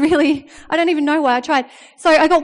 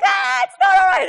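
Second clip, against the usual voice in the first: mock shout. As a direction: yell it back at the son, frantic. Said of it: "I'm like".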